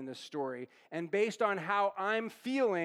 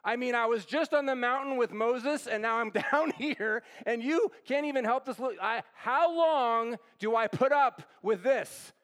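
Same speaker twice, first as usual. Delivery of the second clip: exasperated outburst; 4 semitones higher, 4 dB louder than usual. Acted. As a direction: vent like someone worn out by a heavy load. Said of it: "So we might translate this".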